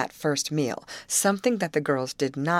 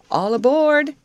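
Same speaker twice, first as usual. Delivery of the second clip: high, loud boarding call; ringing, drawn-out shout to passengers; crying out; 11 semitones higher, 9 dB louder than usual. A narrator's reading. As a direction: call out like a conductor. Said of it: "cried Betty as the engine was started".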